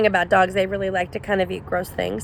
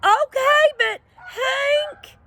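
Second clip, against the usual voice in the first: high, whiny little-dog protest; high-pitched worried whine; Drover's voice; much slower than usual. A narrator's reading. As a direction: cry out his name like a frightened pup.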